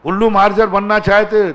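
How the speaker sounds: high, loud rhetorical challenge; challenging question. Real, not acted. Preacher loudly questioning the congregation.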